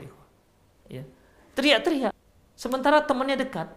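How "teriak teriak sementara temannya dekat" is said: high-pitched mock yelling, only moderately louder; demonstrating loud talk. Shown as a demonstration. Teacher mimicking shouting though the friend is near, high raised voice. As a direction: raise the voice as if angry. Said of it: "seperti orang marah lah gitu".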